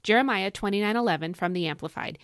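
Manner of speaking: usual speaking voice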